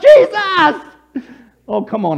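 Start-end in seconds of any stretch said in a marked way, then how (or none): 0.0-0.8 high, loud frightened squeal; hammed-up shriek for rescue; squealy scream. Sinking Peter crying out to Jesus, squealy terror. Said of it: "squealy voice in the midst of being afraid".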